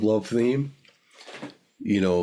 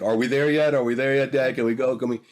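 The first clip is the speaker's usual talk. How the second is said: put-on whiny kid; 4 semitones higher than usual. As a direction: pester like an impatient little boy. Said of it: "Kinda like".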